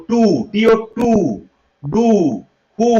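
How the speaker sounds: টু, ডু with a long 'oo'; spaced list modelling টু with 'oo'; 'u' sound demo. Shown as a demonstration. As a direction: hold the 'oo' in হু. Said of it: "এগুলো কিন্তু উ উচ্চারণে পেয়ে গেলাম".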